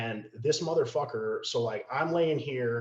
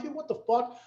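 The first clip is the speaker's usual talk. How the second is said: frustrated disbelief; 12 semitones higher than usual. Retold or imitated. No backup coming.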